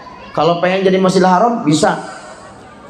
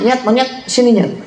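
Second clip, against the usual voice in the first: put-on calling the child; 5 semitones higher than usual. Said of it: "manggil anaknya".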